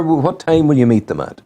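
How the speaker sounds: ordinary delivery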